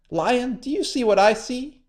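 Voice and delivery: high trickster; high sly spider. A narrator's reading. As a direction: lure him to the rock. Anansi luring.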